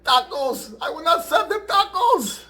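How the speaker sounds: very high, loud mock-grieving woman's voice; wailing Spanish wife. Spanish wife at the funeral, hammed-up wailing. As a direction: play a distraught Spanish widow, hammed up. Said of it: "the Spanish wife goes".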